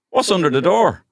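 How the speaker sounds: high, loud, far-off picture-book voice; storybook voice. Shown as a demonstration. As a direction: read it like a big children's book question. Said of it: "the book is like".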